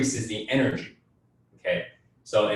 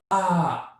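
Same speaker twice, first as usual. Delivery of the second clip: a short, sharp, higher yell; higher, louder yell; 8 semitones higher, 5 dB louder than usual. Shown as a demonstration. Coach shows the urge to yell, with a short higher yell. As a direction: let out a short yelled ah. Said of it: "you feel like you have to yell".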